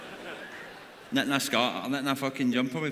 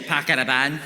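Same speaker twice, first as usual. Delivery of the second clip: Scottish lassie. Retold or imitated. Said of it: "Or as Kaylee would say".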